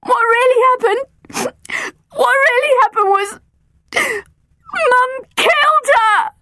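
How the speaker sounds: put-on girl crying as she reveals the truth. A narrator's reading. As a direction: sob out the confession. Crying confession of a murder.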